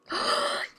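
sharp delighted gasp; quick intake of excited breath. Real, not acted. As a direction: gasp at a happy discovery. Spots the festival she wanted.

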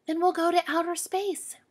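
put-on child bunny proposing a grand trip; excited child. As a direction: announce it with big excitement.

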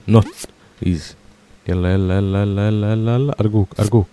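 usual speaking voice

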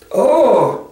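high, knowing Beaver exclamation; scornful Beaver. A narrator's reading. Mister Beaver retorts to witch.

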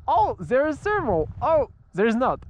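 high-pitched mock delight, then letdown; mock excitement. Pilot mimicking reactions to thermals that vanish, mock excitement.

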